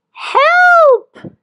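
high, loud cry for help. Acted. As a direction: yell it out, panicked and stuck. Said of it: "it cried".

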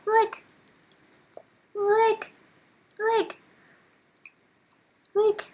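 very high little squeaks; high-pitched pet talk; cooing at pet. Real, not acted. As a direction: coo tiny words in a very high voice. Cooing over a cute pet.

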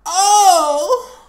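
sudden high, loud burst of a laugh; excited yelp. Real, not acted. Streamer yelping at the game on screen, excited. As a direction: yelp it out, caught off guard.

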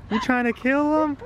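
high alarmed voice. Real, not acted. Alarmed scolding.

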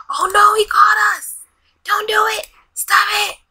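high, loud squeal of mock alarm; a hammed-up yelp at being caught; mock panic. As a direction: play it like caught red-handed, squealing. Caught stealing, panicked voicing.

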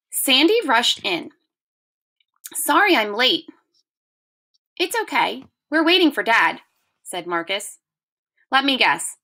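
lively read-aloud, lightly voiced characters; reading aloud to children. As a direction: read it to the class with gentle expression. Teacher reads story to class.

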